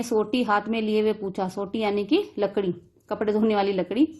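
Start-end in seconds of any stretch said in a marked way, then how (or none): none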